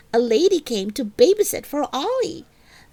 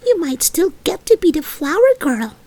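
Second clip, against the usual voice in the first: toy elephant; 6 semitones higher than usual. Acted. Stuffed elephant comforting Junie B in bed, higher and gentle. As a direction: soothe her as a gentle stuffed animal.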